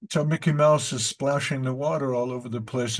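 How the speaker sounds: usual speaking voice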